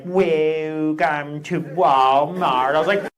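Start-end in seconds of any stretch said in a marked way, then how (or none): 0.0-2.7 slow, dry, unwelcoming "Welcome to Walmart"; sarcastic greeting. Retold or imitated. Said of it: "sound a little sarcastic". 3.0-3.1 higher, louder comeback yell; mock angry shout. Snapping back at greeter.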